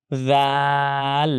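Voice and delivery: "জাল" as a long th-like buzz with open a; tongue-tip articulation. Shown as a demonstration. Teacher recalls a learnt letter, tongue-tip pronunciation. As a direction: stretch "জাল", tongue tip touching the teeth tips. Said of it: "দাঁতের আগার সাথে লাগে".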